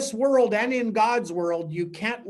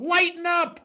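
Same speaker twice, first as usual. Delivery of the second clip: much higher, ringing proclamation of lighten up; proclaimed to the world; 9 semitones higher, 3 dB louder than usual. Acted. Preacher announcing Calvary's message to the world, high and ringing. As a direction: call it out to the whole world like good news. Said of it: "a word to the world".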